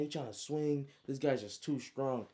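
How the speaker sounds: usual speaking voice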